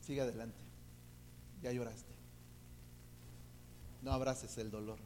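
low, hushed voice with long pauses; God gently speaking. Retold or imitated. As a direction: speak softly and low, as quiet divine counsel. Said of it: "como si Dios me dijera". (voz de Dios, baja y pausada)

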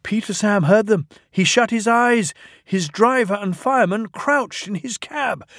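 high-pitched, urgent storytelling; tense narration. A narrator's reading. Narrator tells of trucks about to crash, tense. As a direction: raise the pitch as danger closes in.

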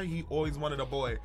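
ordinary talk, nothing marked

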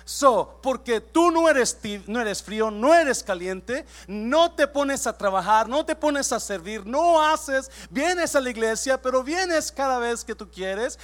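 loud, slow, emphatic preaching; preaching at full force. Acted. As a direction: hammer each line home to the church. Pastor preaching to congregation. (predicación a todo pulmón)

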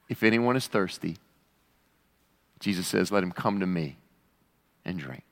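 low, hushing toward the end; gentle invitation. Acted. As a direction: offer it solemnly, with long pauses. Invitation restated solemnly, after earlier shout.

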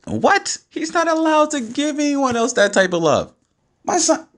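very high-pitched jealous outburst; incredulous protest. Real, not acted. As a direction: protest in squealing disbelief. Jealous outburst over son's love.

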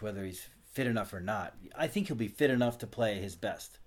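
ordinary delivery

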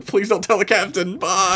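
mock awkward plea, pitched high; awkward exit. Officer fleeing an awkward chat with the bartender, mock pleading. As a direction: play a man lightly panicking to get away. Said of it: "He wants out of this awkward".

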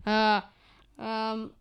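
speaking through a burning mouth; pushing words past chili heat. Real, not acted. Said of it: "it actually really burns".

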